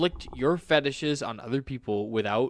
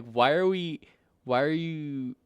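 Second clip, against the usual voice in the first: ordinary talk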